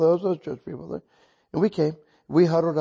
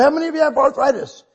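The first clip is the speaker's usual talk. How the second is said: loud, high call to the crowd; calling to congregation; 11 semitones higher, 8 dB louder, faster than usual. Retold or imitated. Preacher asks who has arthritis, calling out loudly. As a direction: throw the question to the back pews.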